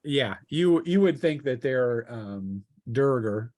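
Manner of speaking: usual speaking voice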